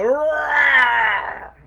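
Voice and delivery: loud, high shouted roar; fierce bear roar. Acted. Three bears roaring at the sleeping girl, loud roar. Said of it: "fierce and noisy growl".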